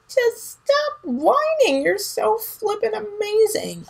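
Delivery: playful high-pitched scolding of Scorpio; high mock scolding.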